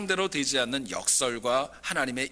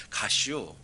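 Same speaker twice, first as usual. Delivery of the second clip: short, lower Chungcheong drawl; Chungcheong dialect; 5 semitones lower, slower than usual. Retold or imitated. Preacher mimics Chungcheong speech for the congregation, terse low Chungcheong dialect. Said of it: "충청도는 아주 간단합니다".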